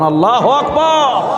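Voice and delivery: high, drawn-out call of praise; crowd-rousing takbir. Real, not acted. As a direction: lead the crowd in a ringing cry. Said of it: "চিল্লা বলেন". (জনতাকে জাগিয়ে টানা তাকবীরের হাঁক)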